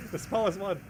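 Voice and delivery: strained voice after pain; stubbed toe. Real, not acted. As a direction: still wincing from the stubbed toe. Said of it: "I just stubbed my toe".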